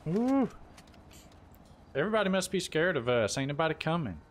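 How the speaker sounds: ordinary delivery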